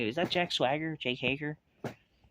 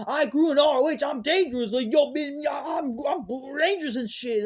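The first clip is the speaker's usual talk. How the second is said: high, loud mock tough talk; hammed-up mock boasting; mocking imitation; 13 semitones higher, 5 dB louder than usual. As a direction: parody a wrestler's boastful promo, pitched high. Mocking a wrestler's tough promo.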